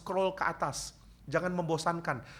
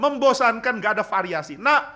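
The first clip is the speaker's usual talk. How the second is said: much higher, louder whining complaint; complaining mom; 9 semitones higher, 10 dB louder than usual. Retold or imitated. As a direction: whine like a bored, fed-up mother. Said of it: "Kalau mamanya bilang". (ibu mengeluh bosan, suara tinggi dan keras)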